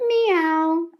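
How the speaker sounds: high little cat mew; the cat calling to the trapped children; cat meow. A narrator's reading. Cat meowing.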